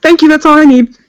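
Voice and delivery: snappy, done-with-you thank you; curt dismissive thanks. Acted. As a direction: cut him off with a quick thanks.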